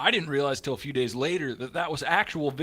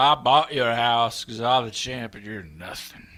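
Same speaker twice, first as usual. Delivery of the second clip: loud, slow, swaggering boast; boastful drunk; 10 dB louder, slower than usual. Retold or imitated. Drunk champion claiming the house as his, loud and slow. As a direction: brag like you own the place.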